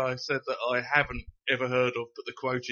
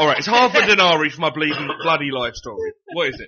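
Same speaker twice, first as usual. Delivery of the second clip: loud outraged Scottish rant, louder; outraged shout; 3 semitones higher, 10 dB louder than usual. Acted. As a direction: give it full outrage. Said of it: "I went a bit Billy Connolly".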